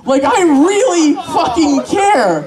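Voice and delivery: slow, high-pitched exasperated insistence; comically furious. Acted. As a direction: drag out each word in mock outrage. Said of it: "I'm so mad".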